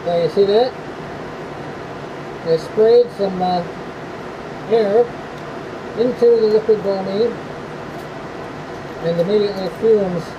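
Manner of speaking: muffled, speaking through a respirator mask. Real, not acted. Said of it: "I'm all geared up in the respirator".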